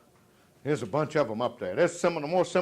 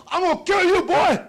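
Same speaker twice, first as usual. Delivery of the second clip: high, loud mock threat; imitating angry father; 14 semitones higher, 9 dB louder than usual. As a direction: holler it like a furious dad. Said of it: "he'd go".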